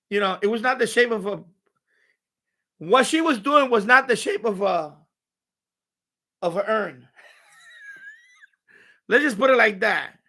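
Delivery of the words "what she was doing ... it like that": ordinary delivery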